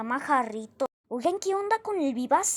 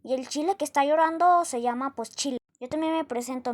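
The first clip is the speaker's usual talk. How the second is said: ordinary delivery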